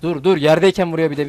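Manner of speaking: loud protest. Real, not acted. Gamer protesting a hit while down, loud and indignant. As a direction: shout out in real indignation at the game.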